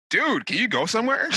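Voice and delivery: high, exasperated "dude, go somewhere"; exasperated plea. Retold or imitated. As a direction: blurt it out in disbelief. Retold reaction to crying.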